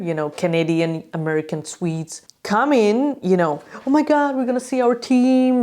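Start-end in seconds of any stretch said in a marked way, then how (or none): 3.8-5.5 higher-pitched, gushing excitement; excited foreign fan. Acted. Foreign fan arriving to see their team, excited. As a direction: gush like a thrilled visiting fan. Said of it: "imagine being a foreigner".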